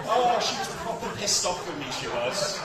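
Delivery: ordinary delivery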